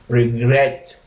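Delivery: "regret" with GRET pushed harder; stress on last syllable. Shown as a demonstration. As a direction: put the weight on the end of "regret". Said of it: "We increase our sound here, put stress on the last part".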